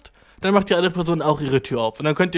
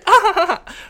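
high, loud put-on cackle; playful laugh; 5 dB louder than usual. Punchline of an imagined flirt, playful laugh. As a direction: burst into a squealing mock laugh. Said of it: "So dachte ich das".

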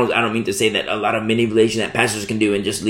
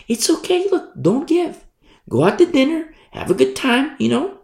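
much higher, light permissive voice; high playful reassurance; 12 semitones higher than usual. Real, not acted. Preacher to congregation, high light permission.

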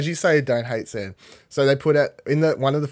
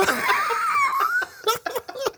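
high burst of real laughter; wild cackle. Real, not acted. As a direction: crack up at your own bit. Laughing at own reenactment.

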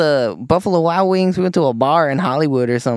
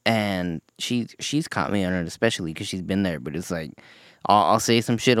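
ordinary talk, nothing marked

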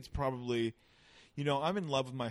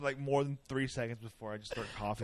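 usual speaking voice

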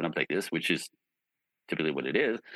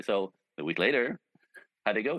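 ordinary talk, nothing marked

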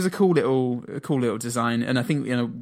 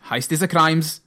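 lightly put-on character voice, pitched up; a hint of a comic impression, higher; Adam Buxton; 5 semitones higher, 4 dB louder than usual. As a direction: quote it in a mildly silly raised voice. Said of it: "kind of like Adam Buxton".

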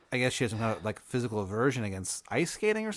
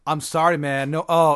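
flustered mock apology, a bit raised; freaking out; 4 semitones higher, 7 dB louder than usual. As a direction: apologize in a mild acted panic. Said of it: "He's, like, really freaking out".

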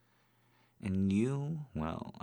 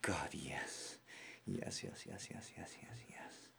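fading whisper; 9 dB quieter than usual. Acted. Fading after climax.

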